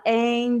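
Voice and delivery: "and" stretched out while thinking; drawn-out and. Shown as a demonstration. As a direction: hold the "and" like you're searching for an answer. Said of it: "ainda alonga". ("and" alongado, pensando na resposta)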